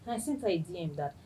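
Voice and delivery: ordinary delivery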